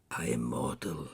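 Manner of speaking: low, loud protest; remonstrating. A narrator's reading. Scrooge remonstrating with the spirit, low and loud. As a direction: protest heavily, pitched well down. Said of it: "Scrooge remonstrated".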